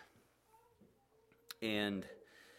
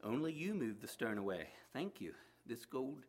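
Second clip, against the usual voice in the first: lively read-aloud storytelling voice with acted characters; story narration; 3 semitones higher than usual. A narrator's reading. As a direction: tell the story to the listeners. Reads a story aloud to congregation.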